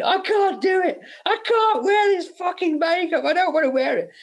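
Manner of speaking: very high, hammed-up tearful whine; mock crying. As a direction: wail it like an actor having a meltdown. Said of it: "crying, going".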